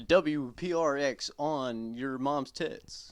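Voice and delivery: slow mock station ID; radio DJ voice. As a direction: drawl the call letters like a DJ.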